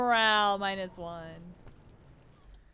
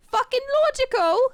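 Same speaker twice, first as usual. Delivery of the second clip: put-on high, loud bratty kid scoff; snorting kid; 9 dB louder than usual. Kuno scoffing at the detective, high bratty snort. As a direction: spit it out like a mocking brat. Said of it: "he snorts".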